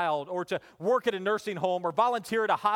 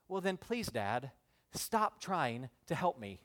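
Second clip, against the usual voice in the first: soft, low, solemn plea; earnest child's plea; 3 semitones lower, 6 dB quieter than usual. Retold or imitated. Son to dad after discipline, in all seriousness. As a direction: plead quietly and very seriously, slowing down. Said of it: "in all seriousness".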